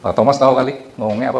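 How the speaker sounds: ordinary talk, nothing marked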